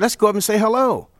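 high, eager put-on voice; lightly mimicked high-voiced fan. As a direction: lift it high, like a keen fan.

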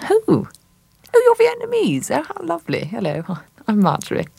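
very high, gushing lady voice; patronising hostess. Acted. Marjorie gushing over the artist, very high and overdone. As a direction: gush at him in a high fluting voice. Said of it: "she says".

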